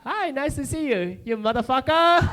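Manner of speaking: high, eager, polite greeting. Retold or imitated. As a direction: greet him proudly. Greeting girlfriend's father.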